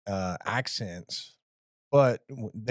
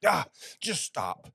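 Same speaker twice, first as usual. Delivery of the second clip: higher, slow, weary just stop; exasperated; 4 semitones higher than usual. Retold or imitated. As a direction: give up on him, exasperated. Exasperated controller.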